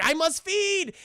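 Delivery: high, strained hammed-up hungry cry; Michael Morbius. Vampire about to drain people, melodramatic hunger. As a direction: declare it like a desperate vampire. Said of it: "Michael's like".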